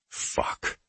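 breathy whispered swear; whispered curse. Acted. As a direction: hiss it under your breath. Narrator's inner curse, unvoiced.

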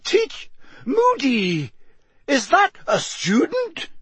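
very high horrified shriek. A narrator's reading. McGonagall realising the ferret is a student, shrieking. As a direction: shriek in disbelief, word by word. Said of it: "shrieked Professor McGonagall".

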